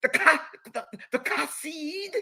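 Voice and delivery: gag on the K to mock them; mock Hebrew. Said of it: "you choke on your cat".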